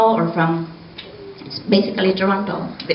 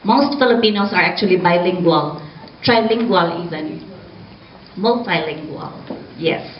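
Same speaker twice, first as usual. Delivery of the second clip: usual speaking voice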